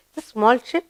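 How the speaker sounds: usual speaking voice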